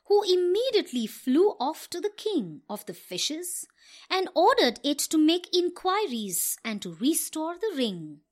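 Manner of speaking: ordinary delivery, nothing marked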